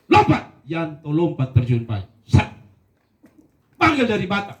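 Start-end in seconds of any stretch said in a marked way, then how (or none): 0.1-0.6 loud, high command; shouted order. Retold or imitated. Commander orders the recruit to jump, shouting. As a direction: bark the order like a drill sergeant.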